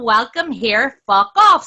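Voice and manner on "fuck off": sudden shouted fuck off; shouting. Real, not acted. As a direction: yell it at them. Heated argument, shouted dismissal.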